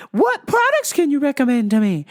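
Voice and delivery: high, loud, overeager customer voice; Diet Coke lady. Retold or imitated. Customer asking about weight loss, caricatured high voice. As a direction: play a hammed-up woman customer. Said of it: "Here she is, Diet Coke lady".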